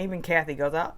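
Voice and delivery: usual speaking voice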